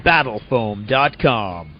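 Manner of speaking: slow drawn-out brand name; ad tagline. Acted. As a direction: land the brand like a tag.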